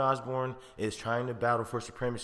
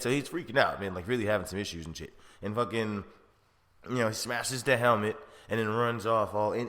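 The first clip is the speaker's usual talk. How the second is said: ordinary talk, nothing marked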